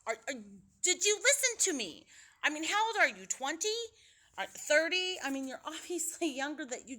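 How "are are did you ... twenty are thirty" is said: loud, higher, demanding scolding; fed-up pretend rant; exasperated shout. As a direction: yell down the phone at her. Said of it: "grab her through the phone and be like".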